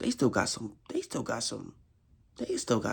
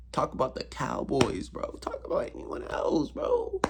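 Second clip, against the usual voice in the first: pleading whisper; 2 semitones higher, 5 dB quieter than usual. Real, not acted. Pleading for others to stop.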